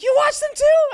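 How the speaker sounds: very high, loud, amazed shout; imitated freak-out. Streamer learns friends are fans too, imitated freak-out. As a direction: squeal the question like a thrilled fan. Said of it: "everybody started freaking out".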